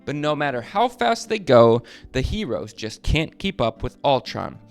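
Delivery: ordinary delivery, nothing marked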